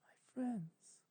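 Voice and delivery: unvoiced breathy whisper. Twilight names her friends on the shore, whispering. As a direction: let it out as a bare whisper. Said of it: "a voice barely above a whisper".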